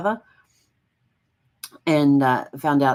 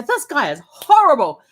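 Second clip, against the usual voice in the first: higher and louder outrage; irate; 11 semitones higher, 8 dB louder than usual. Retold or imitated. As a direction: spat at the book. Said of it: "yes, I will get irate".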